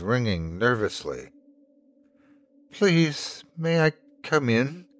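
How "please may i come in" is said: put-on high, sweet child's voice; sweet little girl. A narrator's reading. Little girl on the doorstep asks to come in, sweetly. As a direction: ask sweetly, pitched up like a small child. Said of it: "the little girl asked sweetly".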